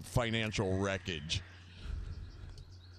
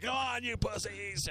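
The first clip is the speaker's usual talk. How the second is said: mock drunken taunt; 12 semitones higher than usual. Drunk idiot goading his friends, mock taunt. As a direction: egg them on in a put-on high voice.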